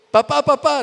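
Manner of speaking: put-on high, loud child's call; child calling dad. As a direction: call out to dad like an eager little boy. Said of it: "un niño argentino dice".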